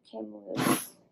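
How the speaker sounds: usual speaking voice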